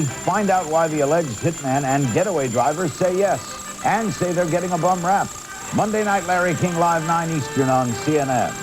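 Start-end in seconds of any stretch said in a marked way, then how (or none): none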